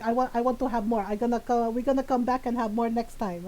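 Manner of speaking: put-on Filipino-accented mom brushing it off; mom's Filipino accent. Mom praising the gelato, in her Filipino accent. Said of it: "in my mom's voice".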